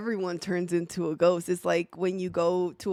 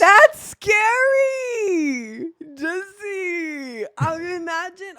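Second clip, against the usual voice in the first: mock scared; 8 semitones higher, 6 dB louder, much slower than usual. Scared by the imagined ghost.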